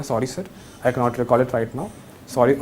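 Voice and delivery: gentle sorry to sir; lovingly polite. Shown as a demonstration. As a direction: apologise sweetly to the panel. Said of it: "एकदम प्यार से बोलना".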